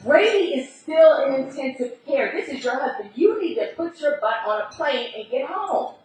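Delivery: pleading brother. Retold or imitated. Twin brother pressures his sister to fly home, loud and urgent.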